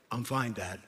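put-on a toddler trying to sound deep; mock deep kid voice; deep-voiced toddler. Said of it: "He has this really deep voice".

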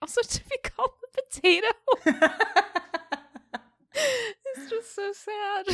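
high, squeaky talk through laughter; laughing. Real, not acted. Co-host pities the boy called potato, laughing hard. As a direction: barely get it out while cracking up. Listener cracks up at potato joke.

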